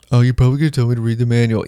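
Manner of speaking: fast, put-on reply; asker's voice. Person asking about car noise, quick mock voice. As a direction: rattle off the obvious answer. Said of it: "acted dialogue with asker".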